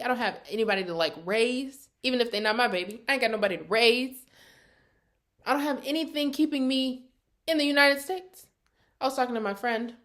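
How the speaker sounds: ordinary talk, nothing marked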